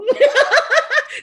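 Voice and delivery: high, loud laugh; burst of high laughter. Real, not acted. Fan laughing at her fake-calm phone voice, in a high laugh. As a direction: laugh at yourself.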